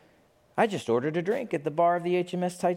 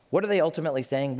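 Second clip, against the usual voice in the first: usual speaking voice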